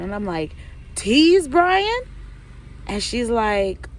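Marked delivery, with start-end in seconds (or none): 1.0-2.0 higher, louder stunned disbelief; shocked question. Retold or imitated. Stunned teller learning Taylor dates T's boyfriend, shocked. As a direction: blurt it out in disbelief.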